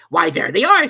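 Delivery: loud, high crowing king; triumphant. A narrator's reading. The King points to the tarts, triumphant and loud. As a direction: crow it like you've won. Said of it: "said the king triumphantly".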